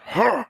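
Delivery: high-pitched game-character exertion noise; imitated jump grunt. Imitating the Quake two jump sound, high grunt. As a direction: mimic a short, high jumping grunt. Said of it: "The guy goes".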